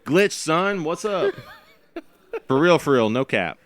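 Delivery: put-on high, loud slangy teen voice; ironic zoomer. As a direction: ham up the Gen Z slang. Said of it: "talking like a Zoomer".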